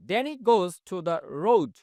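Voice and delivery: each word of "He lives in a small house" said very slowly and clearly; slow model reading. Shown as a demonstration. Teacher to Bangla class, a very slow model sentence. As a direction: dictate it word by word to students. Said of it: "teacher reads model sentence slowly".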